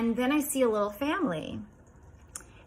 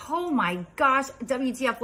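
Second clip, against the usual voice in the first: retold gasping disbelief at a higher pitch; shocked reaction; 5 semitones higher, 3 dB louder than usual. Telling how she saw a giant snake, shocked.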